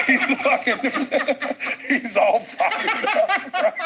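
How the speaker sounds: breaking into high laughter mid-sentence; can barely get the words out for laughing. Real, not acted. Cracking up while telling friends about the dog-like eagerness, laughing hard.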